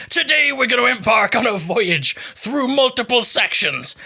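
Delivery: slow hammed-up pirate proclamation. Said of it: "Why am I talking like a pirate?".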